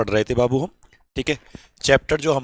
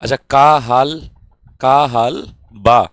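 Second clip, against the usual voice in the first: slow, louder Bhojpuri का हाल बा with a drawn-out का; Bhojpuri greeting; 8 dB louder, slower than usual. Shown as a demonstration. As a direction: stretch out का हाल बा loudly like a Bhojpuri speaker. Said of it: "Bhojpuri में बोल के दिखाएंगे आज". (ज़ोर से और धीरे भोजपुरी लहजे में का हाल बा)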